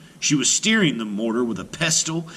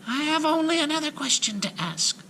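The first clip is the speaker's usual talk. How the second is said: whispered. A narrator's reading. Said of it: "whispered Marusha".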